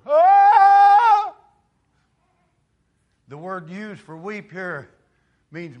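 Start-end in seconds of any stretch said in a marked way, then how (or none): 0.1-1.4 loud high wailing "Oh!"; a long mourning wail; wailing cry. Retold or imitated. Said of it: "You've heard 'em".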